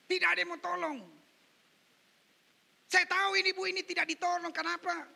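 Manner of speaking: loud, high pulpit indignation; preaching. Real, not acted. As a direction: challenge the congregation forcefully. Preaches at full force.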